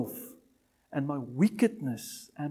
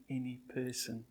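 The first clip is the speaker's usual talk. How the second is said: ordinary talk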